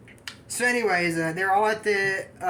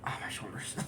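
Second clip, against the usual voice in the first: breathy whispered complaint; whispered "oh, my shoulders"; whispered aside; 8 semitones lower, 14 dB quieter, faster than usual. Real, not acted. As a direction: whisper it to yourself, wincing. Sudden aside about own shoulders.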